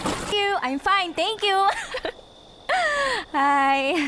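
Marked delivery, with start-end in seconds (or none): 2.7-3.3 a high, light giggle; flirty laugh. Acted. Kay giggling as she drops in on him. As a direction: giggle as you greet him. Flirty arrival at his door.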